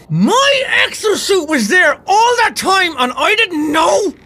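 high, drawn-out exasperated wail; wailing disbelief. Real, not acted. Gamer learns the exosuit was there all along, wailing. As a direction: cry out slowly, aghast.

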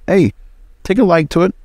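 normal talking voice

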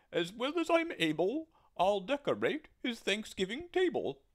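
proud squawking turkey; gobbling turkey. Acted. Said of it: "said the turkey".

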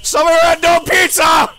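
loud, slow call at a door. Acted. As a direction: shout the name to the house. Calling out at the door.

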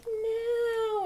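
put-on high, whiny drawn-out child's no; Stewart. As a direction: whine it like a naughty TV child. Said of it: "And he'd be like".

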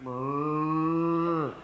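long buzzing lip trill, loud; a cow's sound from a blown-up glove; a hammed-up animal noise; loud lip-trill moo. On all fours, glove udder.

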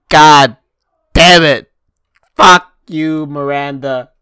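mock outburst. Playing the pranked victim yelling at Miranda, a hammed-up furious shout. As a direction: blow up in fake rage.

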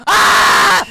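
loud sudden scream of fright. Real, not acted. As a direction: shriek in panic. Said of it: "why I screamed like".

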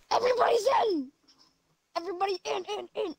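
high excited yell. Real, not acted. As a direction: shout everyone in. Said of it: "Dude, don't yell".

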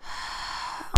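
heavy voiceless exhale after a fright; shaken sigh. Real, not acted. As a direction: let the breath out, recovering. Recovering from a scare.

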